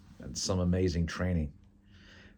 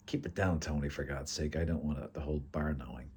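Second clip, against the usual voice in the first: hushed reproof; 5 dB quieter than usual. Acted. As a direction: hush your friend discreetly. Hushing a loud friend in bar.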